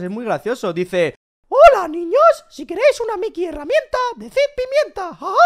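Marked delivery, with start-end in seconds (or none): 1.5-5.3 high, loud cartoon mouse voice; imitating Mickey Mouse. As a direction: do Mickey's squeaky greeting, much higher. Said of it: "¿Me estás imitando?". (imitando a Mickey con voz aguda)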